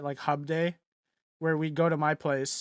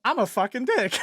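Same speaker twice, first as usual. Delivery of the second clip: higher mock-blunt announcement; Gordon Ramsay; 7 semitones higher, 4 dB louder than usual. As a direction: throw it out as a comic reveal. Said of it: "And he's like".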